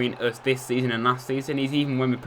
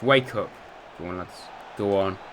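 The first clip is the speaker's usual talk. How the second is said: normal talking voice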